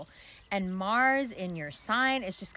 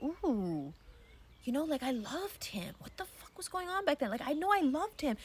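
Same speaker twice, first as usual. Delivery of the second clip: a stunned "ooh" that turns into disbelief; higher, astonished inner voice looking back; dawning realization; 7 semitones higher than usual. Retold or imitated. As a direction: pitch jumps higher, wondering aloud to yourself. Inner voice realizing past love.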